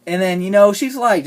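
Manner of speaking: ordinary talk, nothing marked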